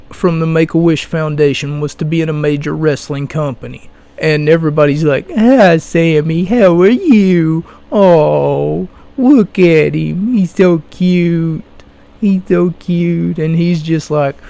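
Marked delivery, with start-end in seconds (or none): 5.3-13.4 put-on slow, cooing baby talk; patronizing, drawn-out gushing; mock fawning. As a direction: greet him like an adorable toddler, dragging the words. Everybody fawning over him, imitated.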